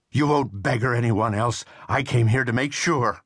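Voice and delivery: a ruined man's resolve, pushed high and loud; menacing Riley. A narrator's reading. Riley reveals his threat.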